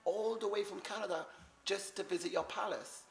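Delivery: high, hammed-up British accent greeting the Queen; a Canadian faking a posh British accent; mock British accent. Hammed-up British accent calling to the Queen at the palace. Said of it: "I tried to talk with a British accent".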